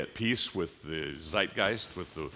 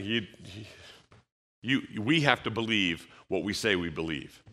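normal talking voice

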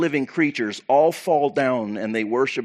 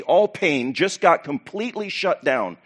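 usual speaking voice

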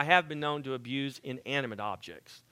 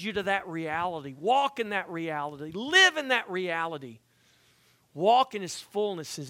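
higher and louder, rousing the congregation; fervent exhortation; 4 semitones higher, 4 dB louder than usual. Acted. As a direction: urge them on with mounting fire. Preaching at full force.

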